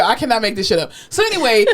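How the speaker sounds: normal talking voice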